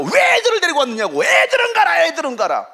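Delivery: high, forceful imitated telling-off; angry scolding. Disciples scolding parents who brought children, high angry shout. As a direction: shoo them away in mock anger. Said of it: "막 화를 내면서".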